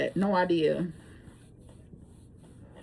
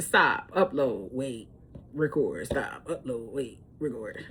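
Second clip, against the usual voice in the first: lower, fading, tired monotone; weary list; 7 semitones lower, 8 dB quieter, slower than usual. Real, not acted. Describing her tedious recording routine, fading weariness. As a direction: trail off wearily through the chores.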